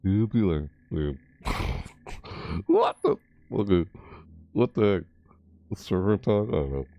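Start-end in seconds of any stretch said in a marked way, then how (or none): 2.7-3.2 very high yelp; squeaky startled. Real, not acted. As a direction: yelp in surprise.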